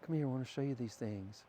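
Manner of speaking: soft, tender invitation; hushed, imagined parent coaxing children; gentle parent. Pretend. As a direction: call the kids over softly.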